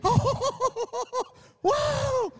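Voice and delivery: put-on high, wondering baby eagle voice; awed little eagle. Preacher voicing the eaglet as it first rises. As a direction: gasp it out like a wide-eyed eaglet.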